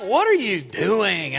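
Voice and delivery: slow, lightly voiced wife; wife's alarmed question. Wife in the car asking what he's doing, slow and lightly voiced. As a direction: ask it slow, like a worried wife. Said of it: "And Malia's like".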